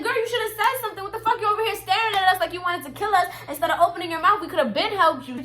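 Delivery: high-pitched, fired-up telling-off; exasperated scolding. Retold or imitated. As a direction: scold a friend in disbelief. Retells telling off the girl.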